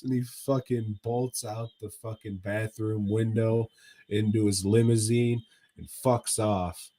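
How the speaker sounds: usual speaking voice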